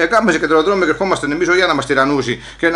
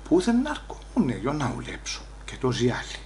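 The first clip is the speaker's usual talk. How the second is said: bitter muttered aside; 3 semitones lower, 12 dB quieter than usual. Retold or imitated. Bitter aside about thieving Americans.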